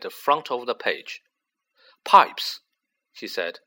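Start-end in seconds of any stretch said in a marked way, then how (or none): 2.0-2.6 higher and louder, struck by an idea; sharp exclamation. Acted. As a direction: snap the word out. Harry realizes.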